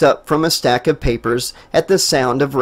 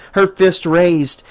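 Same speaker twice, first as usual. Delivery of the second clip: ordinary delivery, nothing marked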